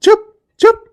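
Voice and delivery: very high, very loud yell; furious shout. Acted. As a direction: roar at her to shut up. Father shouting at crying child.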